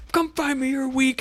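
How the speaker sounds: high, taunting villain; Bowser voice. Retold or imitated. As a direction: sneer it up high like a bully. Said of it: "Bowser's like".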